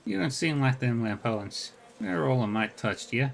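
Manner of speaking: lilting Irish brogue, easygoing; a friendly Irish arcade regular, talked lightly; lyrical Irish accent. Acted. GM voices Rainbow.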